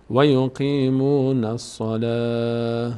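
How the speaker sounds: الصلاة ending in h as "salah", slow chant; stop on h. Shown as a demonstration. Teacher repeats pausal form for students, final h. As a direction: close الصلاة on a breathy h.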